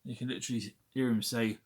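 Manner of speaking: normal talking voice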